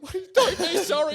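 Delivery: high, loud don't be sorry; hammed-up reassurance; mock protest. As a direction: protest the apology loudly. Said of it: "I'm like".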